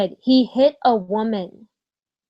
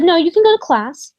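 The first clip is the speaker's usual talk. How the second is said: curt dismissive mock of the director, higher and louder; 7 semitones higher, 6 dB louder than usual. Retelling how the director refused her call, mock curt. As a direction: brush her off like a bored official. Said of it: "And she said".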